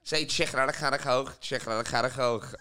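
Scouse, the Coke with a throaty ch at the end; broad Liverpool accent demo. Shown as a demonstration. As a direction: say it thick Scouse, the k breathed rough. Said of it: "That's how you say my accent".

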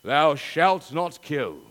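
hammed-up Hollywood Moses voice; Cecil B. DeMille. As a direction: boom it out like a biblical epic. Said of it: "That's my best Cecil B. DeMille".

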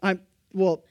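ordinary delivery

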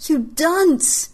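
high, loud furious yell; angry shout. A narrator's reading. Neva shouting at Trice, furious yell. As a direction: scorn hurled at a fool. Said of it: "I shouted at Trice".

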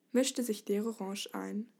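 plain reading voice

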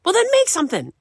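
very high, loud, exasperated mom voice; mock mom. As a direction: snap back like an impatient mother. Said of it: "And she's like".

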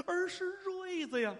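theatrical wail of a returning son; emotional plea. Acted. Son reveals himself to blind mother.

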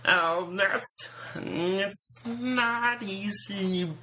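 drawn-out puppet frog singing; Kermit the Frog voice. Pretend. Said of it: "that would be something more like".

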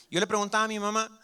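ordinary talk

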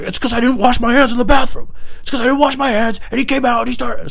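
very high, strained, indignant rant; worked-up rant. Retold or imitated. Pissed-off employee explaining the grudge to bosses, worked-up rant. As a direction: protest in a high, frantic squawk.

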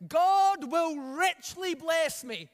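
loud, slow group shout of the line; loud call for the church to repeat; shouted together. Shown as a demonstration. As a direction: lead the congregation in a big declaration. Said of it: "Everybody shout this".

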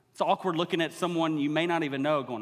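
normal talking voice

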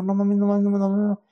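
loud frantic repeated no mames; retold terror, loud and breathless; panicked exclaiming. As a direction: blurt it out in panic while fleeing. Fleeing after hearing rattlesnake.

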